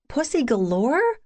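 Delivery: teasing incredulous Pussy Galore?; mocking disbelief. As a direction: throw Pussy Galore back with a scoffing rise. Friend mocks the tattoo name.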